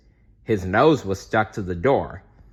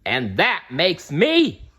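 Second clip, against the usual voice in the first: triumphant boast; 7 semitones higher, slower than usual. A narrator's reading.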